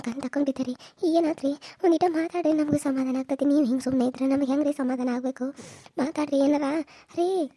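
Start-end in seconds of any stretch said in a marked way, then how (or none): none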